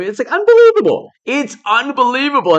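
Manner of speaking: high, loud burst of disbelief; exasperated cry of disbelief; incredulous outburst. Real, not acted. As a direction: throw up your hands, it's unbelievable. Exasperated at uranium deal.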